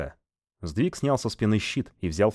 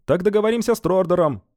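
much higher, loud shout from the crowd; 13 semitones higher, 7 dB louder than usual. Acted. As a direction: yell it out over the hall. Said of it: "выкрикнул кто-то".

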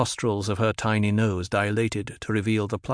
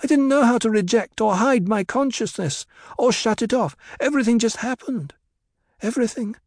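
much higher-pitched character voice; Alia explaining herself; 12 semitones higher than usual. A narrator's reading. Alia telling how consciousness came to her, high earnest voice.

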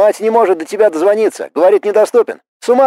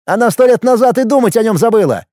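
high, heated harangue; agitated, scornful telling-off; exasperated tirade; 7 semitones higher than usual. A narrator's reading. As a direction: hammer the truth home, pitch climbing. Brother harangues him about his ex.

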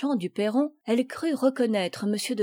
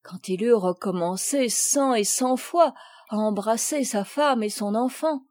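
usual speaking voice

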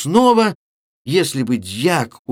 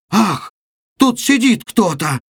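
higher, louder scared cry; frightened shout; 8 semitones higher, 3 dB louder than usual. A narrator's reading. Girls hearing a cough in the sack, frightened cry. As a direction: shriek it as you flee. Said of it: "закричали все и в испуге".

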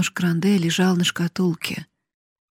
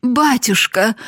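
raised, higher-pitched cry; pleading cry; 5 semitones higher, 4 dB louder than usual. A narrator's reading. Daughter throwing herself at her father's feet, crying out. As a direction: cry out in appeal. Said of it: "закричала Евгения, бросившись перед ним".

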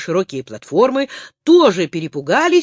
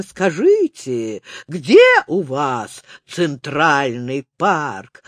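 голос динозавра; 6 semitones lower, slower than usual. A narrator's reading. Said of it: "громко спросил".

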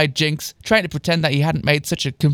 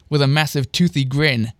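plain reading voice

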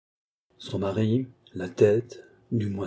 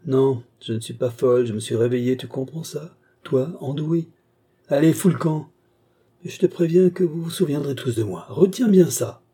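higher, louder, growing to a shout; Marie, fierce; 5 semitones higher, 4 dB louder than usual. Acted. Woman throwing the young man out, shouting a warning. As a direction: drive him out with a warning.

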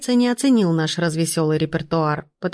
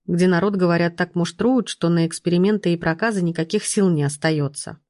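ordinary delivery

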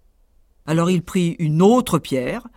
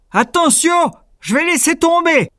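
much higher, louder giant call; booming warning; 12 semitones higher, 6 dB louder than usual. Acted. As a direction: shout the warning. Giant warns.

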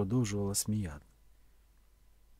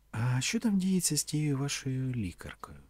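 light, higher, unhurried question; lightly said; 6 semitones higher than usual. A narrator's reading. Woman asks about his lady doctor, lightly. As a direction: toss it out as if offhand. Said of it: "Вона це сказала легенько".

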